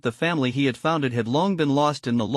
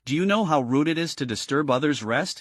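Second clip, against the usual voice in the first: ordinary delivery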